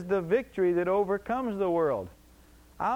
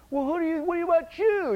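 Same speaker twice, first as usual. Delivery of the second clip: higher, louder indignant comeback; defensive retort; 11 semitones higher, 5 dB louder than usual. Retold or imitated. Believer snapping back at someone who insulted them, indignant retort. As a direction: fire the question back at the accuser.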